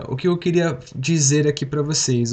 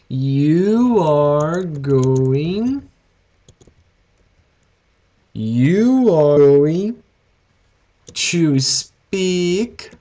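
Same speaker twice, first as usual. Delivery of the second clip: slow and louder, each word leaned on; serious and emphatic; 4 dB louder, much slower than usual. Shown as a demonstration. Teacher insisting students will speak English, slow emphasis. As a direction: drive it home, word by word. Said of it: "I'm saying it seriously".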